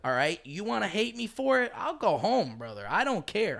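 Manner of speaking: yelled high and loud at a friend; loud mock outburst, high and angry; retold shout. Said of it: "I always used to say that to him".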